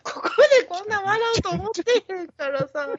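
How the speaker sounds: helpless laughter; crying with laughter; laughing to tears. Real, not acted. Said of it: "涙出てきた".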